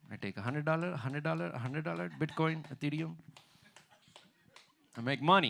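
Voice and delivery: put-on Indian-accented guru taking payment. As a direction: rattle off prices in an Indian accent. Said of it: "say basic shit in Indian accent".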